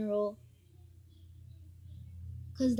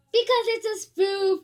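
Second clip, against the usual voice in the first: higher, louder gleeful reveal; excited reveal; 8 semitones higher, 9 dB louder than usual. Real, not acted. Kid revealing the prank to viewers, excitedly. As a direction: blurt out that it was all fake.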